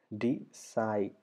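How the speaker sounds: Decide broken into dee and sigh; teacher sounding out Decide in two beats; syllable by syllable. Shown as a demonstration. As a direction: stretch both syllables of "Decide" with a pause between. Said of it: "രണ്ട് syllables വരണം".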